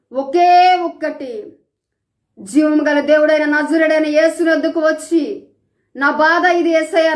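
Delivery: loud, high, pleading preacher's call; impassioned preaching. Real, not acted. Preacher urging grieving listeners to bring tears to God, full-force sermon.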